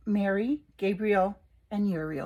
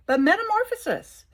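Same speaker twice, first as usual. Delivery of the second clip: much higher and louder, emphatic; excited exclamation; 11 semitones higher, 6 dB louder than usual. Real, not acted. Thrilled about her own change, exclaiming the card word. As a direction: launch the word with enthusiasm.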